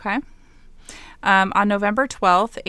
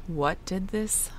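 suspenseful hush; 7 dB quieter, slower than usual. Acted. Suspenseful storytelling question.

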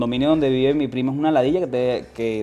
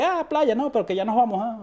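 mock high quote; 10 semitones higher than usual. Quoting a rushed beach visit.

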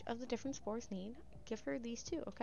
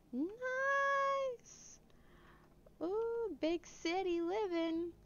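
much higher, squealing delight; excited squeal; 9 semitones higher, much slower than usual. Acted. Thrilled squeal on reaching the big city. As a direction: squeal with glee at the sights.

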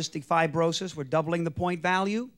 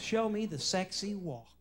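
normal talking voice

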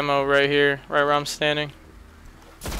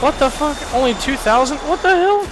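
shocked outburst; 10 semitones higher than usual. Real, not acted. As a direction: cry out in stunned disbelief. Shocked at low damage number.

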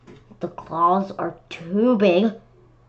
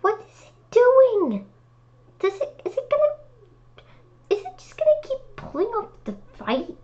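high puzzled disbelief; faster than usual. Real, not acted. Reader puzzled by ladybug.

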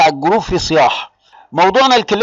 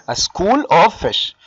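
drawn-out "A school of fish", word by word; very slow teacher's dictation of "A school of fish"; slow, deliberate; much slower than usual. Shown as a demonstration. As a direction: stretch out "school" and "of" for the class. Teacher spells out term slowly.

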